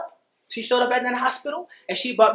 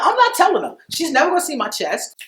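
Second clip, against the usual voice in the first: adamant refusal; 8 semitones higher, 4 dB louder than usual. Retold or imitated. Retold argument with partner about telling mother.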